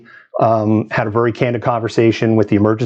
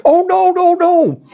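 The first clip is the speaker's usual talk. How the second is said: much higher, louder retold "oh no no"; retold protest; 4 dB louder, slower than usual. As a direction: reassure her in an animated rush. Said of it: "I'm like".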